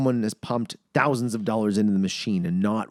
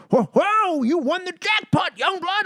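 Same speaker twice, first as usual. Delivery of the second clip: put-on excited old guy; slower than usual. Old guy at the next machine tells the narrator he won, high excited shout. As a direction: play the old neighbour yelling that he hit it big. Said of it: "this old guy next to me is like".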